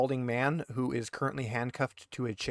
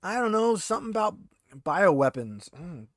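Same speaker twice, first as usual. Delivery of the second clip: a hint of a rattled, defensive prisoner pleading innocence; lightly voiced handcuffed thug, indignant then wheedling; 5 semitones higher than usual. GM as the interrogated prisoner, lightly voiced and defensive.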